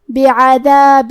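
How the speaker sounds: بعذاب slow, nasal merge into the next word; idgham recited. Shown as a demonstration. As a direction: recite بعذاب with ghunnah and shadda. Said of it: "غنہ اور تشدید کے ساتھ". (بعذاب کو غنہ اور تشدید کے ساتھ پڑھیں)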